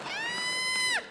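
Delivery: very high, thin falsetto cry; lady's pained squeal. Retold or imitated. Girl held by the neck, high mock squeal. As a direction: squeal softly in mock pain. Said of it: "She's like".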